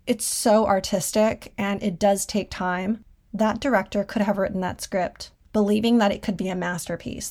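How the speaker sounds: ordinary talk, nothing marked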